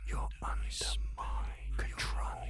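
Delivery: breathy close whisper; whisper in left ear. Acted. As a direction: whisper right into one ear. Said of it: "and whisper into your ear".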